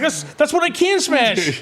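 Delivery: put-on slow, high-pitched childish protest; Hulk. Retelling Hulk's movie line to a co-host, childish Hulk voice. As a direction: stretch it out in a mock sulk. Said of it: "one of Hulk's best lines".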